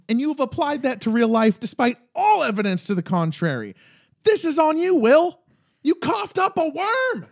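exasperated pretend lecture, pitch climbing high; mock scolding. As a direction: tell off a character as if face to face. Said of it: "I'm talking directly to Will now".

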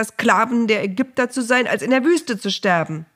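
frightened crowd yelling at Moses; angry shouting. Acted. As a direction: hurl the blame at Moses. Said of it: "Andere schrien Mose an".

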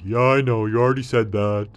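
a thirsty camel perking up; hint of a thirsty camel.

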